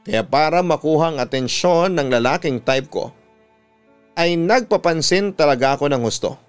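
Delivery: ordinary delivery, nothing marked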